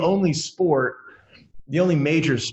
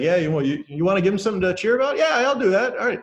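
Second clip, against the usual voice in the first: put-on Canadian accent; 7 semitones higher, faster than usual. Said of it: "in their Canadian accents".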